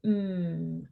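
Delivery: "mm" held long with closed lips; stretched m. Shown as a demonstration. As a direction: hum "mm" without opening the mouth. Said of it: "stretched consonant sound".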